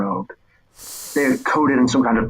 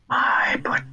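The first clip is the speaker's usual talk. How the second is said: slow unvoiced whisper, faintly amused; whispered figure; 10 semitones higher, slower than usual. Figure whispering amused reply to the boy. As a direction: breathe it out, condescending.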